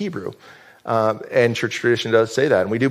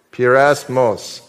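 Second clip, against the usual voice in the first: Pyrasmos drawn out slowly, syllable by syllable; slow model pronunciation; much slower than usual. Shown as a demonstration. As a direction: say Pyrasmos slowly for the room to repeat. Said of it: "So, say that with me".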